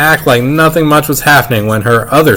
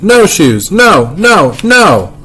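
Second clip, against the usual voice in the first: mock toddler scream; 7 semitones higher, much slower than usual. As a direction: scream it out like a toddler throwing a fit. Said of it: "He screamed".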